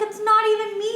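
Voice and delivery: high-pitched indignant protest; retold frustration. Daughter wrongly blamed by mom, high frustrated protest. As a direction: protest your innocence, exasperated. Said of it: "I used to get so frustrated".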